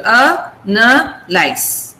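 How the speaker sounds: "analyze" broken into A, na, lies; "A, na, lies" in three separated beats, stepping down; syllable by syllable. Shown as a demonstration. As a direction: separate each syllable of "analyze", A first and strongest. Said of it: "a, the first syllable".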